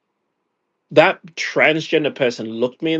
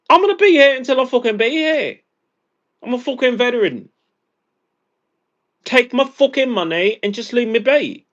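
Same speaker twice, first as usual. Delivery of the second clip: furious high rant; 10 semitones higher, 3 dB louder than usual. Real, not acted. Fed-up veteran ranting at the caller, fast, high and loud.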